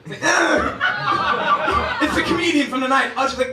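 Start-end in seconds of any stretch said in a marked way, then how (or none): 0.2-2.0 shocked yelp. Retold or imitated. Shocked reaction.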